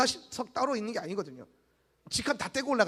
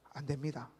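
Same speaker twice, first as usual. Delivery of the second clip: much lower, much softer grudging reply; a resigned church member, quiet and low; muttered answer; 8 semitones lower, 11 dB quieter than usual. Retold or imitated. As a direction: answer under your breath, resigned. Imagined member's reluctant answer.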